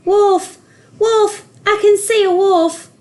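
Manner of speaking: very high, loud desperate yell; shouting. A narrator's reading. Joseph shouts down to the village, frightened yell. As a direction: scream wolf in real fear. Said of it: "He shouted down to the village".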